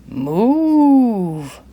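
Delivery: gruff driver calling out; driver calls. Acted. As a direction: tell the cow to shift. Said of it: "the driver called to a cow".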